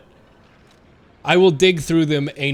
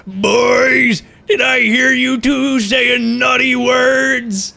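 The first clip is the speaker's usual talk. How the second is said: higher, louder, slow scolding boss voice; Mister Krabs scolding; 7 semitones higher, 6 dB louder, much slower than usual. Retold or imitated. Mister Krabs catching the boys swearing, slow loud scold. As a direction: boom it at the boys like a cranky boss. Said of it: "Mister Krabs like".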